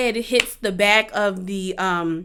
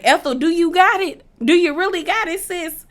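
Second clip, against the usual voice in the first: doubtful sisterly tease in a higher voice; skeptical teasing; 6 semitones higher than usual. Retold or imitated. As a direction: needle her: do you really got it. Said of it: "and I'm like".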